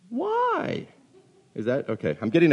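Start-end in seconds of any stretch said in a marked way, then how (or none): 0.1-0.9 very high, squeaky why; cracking kid voice. Acted. Children answering a parent's order, squeaky cracked voice. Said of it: "make my voice crack appropriately".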